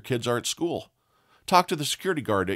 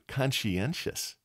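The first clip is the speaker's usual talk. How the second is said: conscientious stretched slow and softer; drawn out slowly; 5 dB quieter, much slower than usual. Shown as a demonstration. Host repeating the advice to listeners, slow and soft. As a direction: linger on each syllable of conscientious. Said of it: "Be conscientious".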